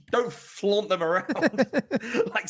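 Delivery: can't get through it without laughing. Real, not acted. Cohost mocks flaunted baguettes, laughing through it.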